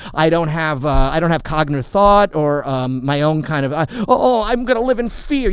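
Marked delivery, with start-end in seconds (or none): 3.9-5.5 high, loud mock panic; mock fear. Comic scoffing at being cancelled, in mock fear. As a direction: pretend to be scared, hammed up.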